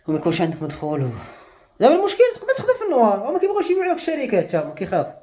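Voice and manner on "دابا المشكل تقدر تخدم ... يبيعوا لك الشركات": bitter laugh through higher words. Real, not acted. Vlogger laughing at the trap he is in, higher. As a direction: laugh it off, voice pitched up. Laughing about his bind.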